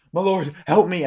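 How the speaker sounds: high, loud plea for help; pleading boy. Acted. Boy begs lord for help.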